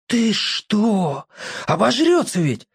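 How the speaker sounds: startled worry about his little sister; alarmed. Acted. Borka alarmed that Nyuska will overeat, lightly voiced. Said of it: "всполошился Борька".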